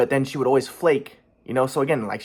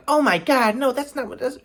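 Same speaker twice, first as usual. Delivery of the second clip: high-pitched mock protest; scandalized mimicry, pitched way up; mimicked shocked denial; 11 semitones higher than usual. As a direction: imitate her flustered denial up high.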